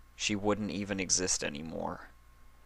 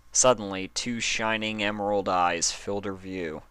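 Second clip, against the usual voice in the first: usual speaking voice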